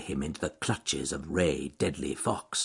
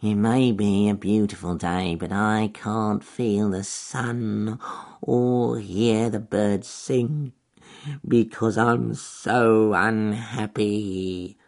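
fake sad weasel; slower than usual. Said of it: "answered Wily the Weasel".

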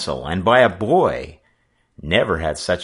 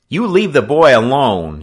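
high, loud order; forceful warning; 7 semitones higher, 9 dB louder than usual. Acted. Sailor shielding the boy from the skipper, forceful. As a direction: warn him off the boy. Frisco Kid defends Joe, armed.

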